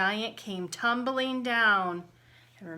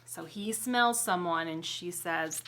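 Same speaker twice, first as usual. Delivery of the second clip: plain reading voice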